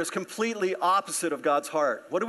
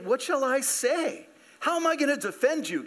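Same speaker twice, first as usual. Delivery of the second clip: desperate cry; 9 semitones higher than usual. Acted. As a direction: plead up high as a despairing leader. Voicing Joshua's desperate prayer.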